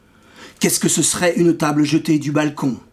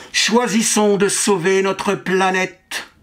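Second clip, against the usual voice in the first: higher, slow, like an amplified public slogan; amplified woman's voice; 4 semitones higher, slower than usual. Acted. Amplified woman calling out behind the poet, higher slow amplified voice. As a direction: imitate a woman's voice over a loudspeaker, drawn out. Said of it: "une voix de femme amplifiée".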